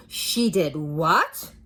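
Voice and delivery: slow, loud outraged disbelief; shocked cry. Acted. King crying out in shock at the soldier's news. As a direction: gasp it out in horror. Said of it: "cried the king".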